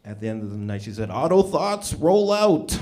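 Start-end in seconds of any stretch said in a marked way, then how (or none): 1.1-2.8 higher, raised parody battle cry; mock heroic command. Comic quoting his Transformer date's farewell, mock rallying cry. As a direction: call it out like a cartoon robot leader.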